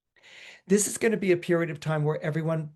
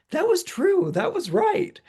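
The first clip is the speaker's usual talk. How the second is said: much higher, bright squeaky concession; friend conceding; 4 dB louder, slower than usual. Retold or imitated. Friend tells the astrologer she was right, much higher mock-squeal. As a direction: squeal it like a giddy friend admitting you called it.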